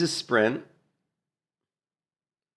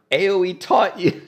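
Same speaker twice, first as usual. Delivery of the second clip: lightly voiced warrior's last words; 8 semitones higher, 4 dB louder than usual. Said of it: "His last words were".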